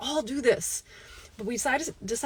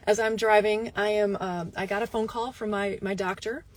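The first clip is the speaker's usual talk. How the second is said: ordinary delivery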